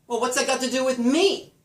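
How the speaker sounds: loud, high indignant question peaking on "me"; stress on "me". Shown as a demonstration. Answering who it has to do with, stressed "me". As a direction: fire it out, landing hard on "me". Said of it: "The stressed word is me".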